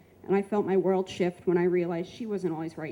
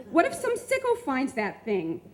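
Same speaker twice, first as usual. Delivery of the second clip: retold screaming; 6 semitones higher than usual. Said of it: "chased out behind me screaming".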